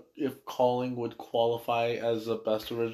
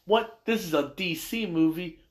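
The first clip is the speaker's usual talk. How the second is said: mock surprise; 6 semitones higher than usual. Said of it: "someone comes in".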